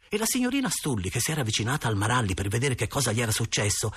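ordinary delivery, nothing marked

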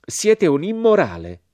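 slow, high, loud condemnation; cold accusation. A narrator's reading. American woman condemning the narrator as immoral, cold. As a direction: pronounce judgement with icy disapproval. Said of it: "con voce fredda".

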